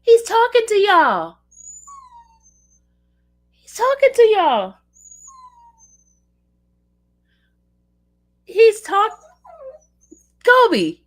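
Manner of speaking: high, loud, gushing pet talk; gushing delight. Real, not acted. Bragging to viewers the dog talks, high gushing squeal. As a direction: gush over the clever dog.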